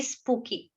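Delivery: "स्पूकी" said as SPOO-kee; correct pronunciation. Shown as a demonstration. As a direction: sound out "स्पूकी" with a long oo after spelling it. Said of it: "spooky is the correct pronunciation".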